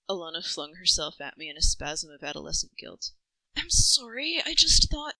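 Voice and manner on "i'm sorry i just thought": high, louder rushed apology; a guilty twelve-year-old's hurried sorry; upset apology. A narrator's reading. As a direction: blurt it out guiltily. Guilty girl flings herself.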